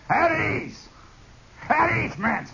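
high, clipped-off command voice; officer's command. Retold or imitated. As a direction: order the men to ease up. Lieutenant addressing the men.

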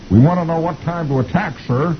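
high innocent cartoon private; Beetle Bailey. Beetle asks the general the attack time, high innocent voice. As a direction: ask it brightly like a naive soldier. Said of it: "He says".

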